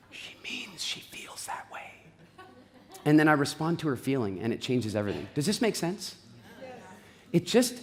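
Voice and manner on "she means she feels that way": hushed, breathy whisper of the translation; whispered aside. Retold or imitated. Husband whispering his translation of wife's words, hushed whisper. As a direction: whisper it like a secret interpreter. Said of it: "I then have to translate".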